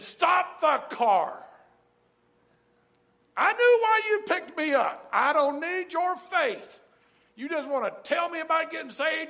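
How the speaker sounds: angry shout. Retold or imitated. Hitchhiker Sal turning on the driver, in a high, loud, furious yell. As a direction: bark it like a hurt, furious man. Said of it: "He said".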